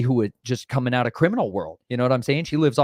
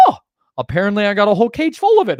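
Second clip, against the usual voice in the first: cashier voice; 10 semitones higher, 7 dB louder than usual. Retold or imitated. Voicing the cashier's reply.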